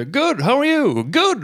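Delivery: high, loud sing-out reply; ropat svar. Retold or imitated. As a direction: imitate dad calling back a routine greeting. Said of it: "Och så ropade min pappa tillbaks". (högt, ljust hälsningsrop tillbaka)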